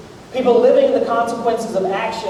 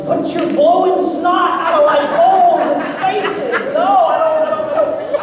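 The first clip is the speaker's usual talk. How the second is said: high, squeaky mock panic about the snot; hammed-up high-pitched recoil from a sick spouse; mock high-pitched protest; 9 semitones higher, slower than usual. Pastor playing himself backing away from his sick wife, high and squeaky.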